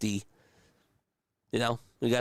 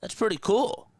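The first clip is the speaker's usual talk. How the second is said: retold amazement, much higher; telling chat how he reacted to seeing the player; impressed wow; 11 semitones higher, slower than usual. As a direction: pitch it up like you're genuinely impressed. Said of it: "and I was like".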